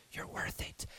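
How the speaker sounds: soft whisper in the ear. Acted. Jesus whispering in your ear, soft reassuring whisper. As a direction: whisper it like a comfort.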